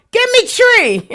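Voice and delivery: high squeaky mock of her saying; playful high-voiced imitation; mimicking Maggie. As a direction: pitch way up to mock the phrase. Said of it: "I find myself".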